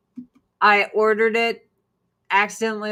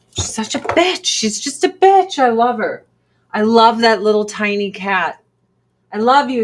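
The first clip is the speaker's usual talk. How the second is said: gushing; 7 dB louder than usual. Real, not acted. Owner gushing loudly over her bratty cat. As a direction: gush over her with loud delight.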